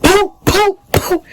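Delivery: high, popping hit noises; imitated punches. Teller imitates reaching round the sister to hit, punchy. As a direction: punch out short high sound effects. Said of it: "I reached around her sister".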